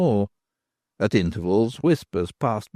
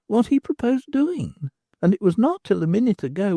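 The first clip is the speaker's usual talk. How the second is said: higher, earnest woman explaining; a young woman laying out what she realised; Nan's voice; 9 semitones higher than usual. Acted. Nan speaks, narrator voicing her.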